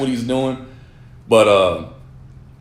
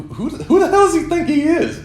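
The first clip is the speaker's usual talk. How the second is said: high, exasperated disbelief; can't believe this guy's nerve; incredulous. Real, not acted. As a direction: pitch shooting up in outrage. Ranting about rude mechanic.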